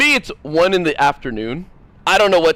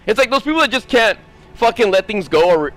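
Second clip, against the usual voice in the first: ordinary talk, nothing marked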